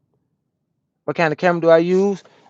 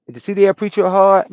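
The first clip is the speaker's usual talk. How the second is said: higher and louder, hitting D; loud repeat; 6 semitones higher, 5 dB louder than usual. Real, not acted. Trucker repeating the question to chat, loud and high. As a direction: repeat it out to the stream.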